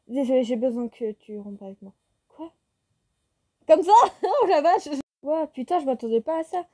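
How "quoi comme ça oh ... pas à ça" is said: high, loud laughing disbelief; shocked exclamation. Real, not acted. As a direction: blurt out in shock. Shocked reaction to breakup request.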